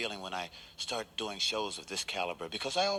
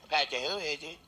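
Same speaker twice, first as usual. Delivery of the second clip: squawky parrot imitation; 3 semitones higher than usual. Parrot answering the knock with a squawky voice. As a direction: screech it like a pet parrot.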